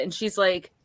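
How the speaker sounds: ordinary delivery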